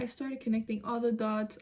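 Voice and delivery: normal talking voice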